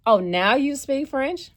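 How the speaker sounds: loud, high, slow mocking cab driver; mocking cab driver catching her out, loud and slow; sarcastic driver. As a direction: throw it back at her with sarcasm. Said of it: "And she was like".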